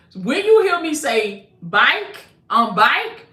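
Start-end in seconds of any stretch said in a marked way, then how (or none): 1.6-3.3 Southern default, vowel in "Bike" drawn wide and rising; Southern accent. Shown as a demonstration. Speaker showing listeners her unguarded default, Southern accent. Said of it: "flowing that old Southern".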